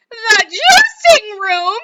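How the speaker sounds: high, loud, slow wail; mother cries out in horror; horrified cry. Acted. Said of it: "cried Missus Beauregard".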